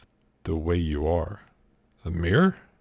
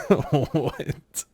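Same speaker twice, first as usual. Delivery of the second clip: laughing what; 4 semitones higher, 5 dB quieter than usual. Real, not acted. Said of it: "You do in a bathroom?".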